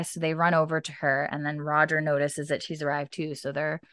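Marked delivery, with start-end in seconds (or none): none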